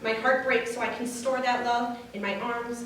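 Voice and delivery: ordinary talk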